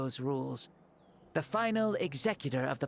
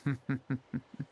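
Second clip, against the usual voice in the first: short laugh; 3 semitones lower than usual. Acted. Laughs at their shock.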